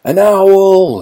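high, loud, drawn-out shout; cry of alarm. A narrator's reading. Woodchuck sees an owl, crying out. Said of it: "he cried".

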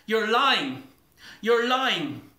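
high, loud, horrified screaming; a girl screams in horror at her parents; hysterical scream. Acted. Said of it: "she screams at them, hysterical, horrified".

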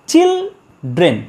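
চিলড্রেন in two slow, separated beats; high, drawn-out চিল, pause, then ড্রেন dropping; syllable by syllable. Shown as a demonstration. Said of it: "দুবার দেখবে তোমার এই চিনটা".

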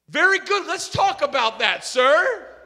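loud, high, pointed challenge; acted argumentative retort; heated comeback. Acted sarcastic, heated comeback in quarrel.

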